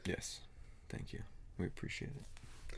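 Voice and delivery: soft unvoiced whisper. Real, not acted. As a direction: whisper your thanks. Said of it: "Why are you whispering?".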